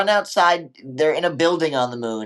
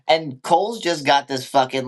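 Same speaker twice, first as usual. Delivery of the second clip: ordinary delivery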